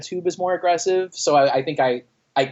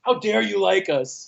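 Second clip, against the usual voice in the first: higher, slow, laughing fake indignation; play-offended through a laugh; mock outrage, laughing; 6 semitones higher, slower than usual. Singer joking he was offended the crowd liked them, laughing.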